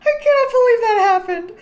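high, loud laugh-crying disbelief. Real, not acted. Player laugh-crying at the twins' birth. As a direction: wail it through laughter.

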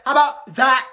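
loud, high defiant retort. Acted. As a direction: throw it at her. Student storming off.

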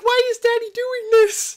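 high-pitched little-kid impression; imitating confused son. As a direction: pipe up like a bewildered child. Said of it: "He's like".

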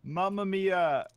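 mock Italian exclamation.

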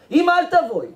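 high, loud outburst at mother; acted yell of protest; exasperated shout. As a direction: snap loudly like an embarrassed son. Embarrassed son shouts at mother.

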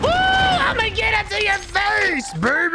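high, slow triumphant yell; gloating shout. Real, not acted. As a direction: rub it in their face, loudly. Gloating after a kill.

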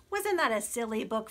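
ordinary delivery

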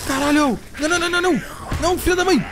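panicked high yelps; scared horror game streamer. Real, not acted. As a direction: scream in real panic as it grabs you. Enemy catches him in horror game.

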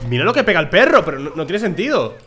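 loud, high disbelief; amazed shout. Real, not acted. Gamer amazed at his dog's hits, loud and high.

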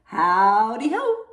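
loud, slow sing-out with rising end; big drawn-out hello; hare's greeting. A narrator's reading. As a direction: stretch it out and swoop upward. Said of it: "Hare hops along and says".

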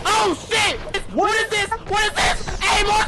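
high, loud frightened shouting; startled yell. Real, not acted. Gamer jumpscared by a creature, loud high yell.

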